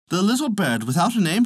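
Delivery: usual speaking voice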